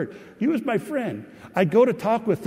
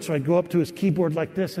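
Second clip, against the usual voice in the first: ordinary delivery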